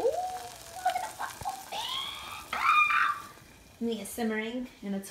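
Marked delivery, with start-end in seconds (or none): 0.0-2.5 squeal. Real, not acted. Excited at sizzling meat. 2.5-3.3 high excited shriek. Real, not acted. Excited at sizzling meat.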